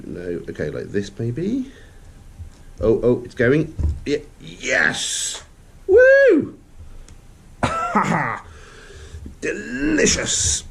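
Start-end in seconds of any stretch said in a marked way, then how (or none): 2.8-8.4 excited. Real, not acted. Pieces finally fit together. 9.4-10.6 high, slow, gleeful; excited high cheer. Real, not acted. Pieces finally fit together.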